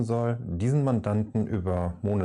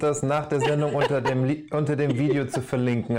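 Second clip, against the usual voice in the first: hysterical laugh; 5 semitones higher, 4 dB louder than usual. Real, not acted. Laughing at the video idea.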